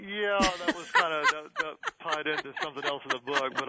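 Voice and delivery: high, loud laughing admission. Real, not acted. Pastor owning up to the circumcision joke, laughing. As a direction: explain it through real laughter. Host teases him, he laughs.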